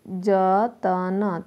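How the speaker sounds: put-on stretched-out word for children; drawn out very slowly, syllable by syllable. Shown as a demonstration. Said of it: "slow teaching demo". (धीरे-धीरे खींचकर)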